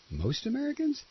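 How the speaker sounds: high, hushed disbelieving echo; sarcastic disbelief at the claim; incredulous scoff. Real, not acted. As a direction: repeat it as if it's absurd. Scoffing at the campaign statement.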